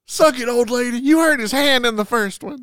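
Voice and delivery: loud, high-pitched heckle; gleeful jeering. Retold or imitated. Viewer jeering the old lady, mock gloating. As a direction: taunt the villain from the audience. Jeers at the screen character.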